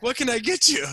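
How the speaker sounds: high-pitched, loud bartender greeting; imitating a woman taking an order. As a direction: chirp it up high over the bar. Bartender voiced at bar.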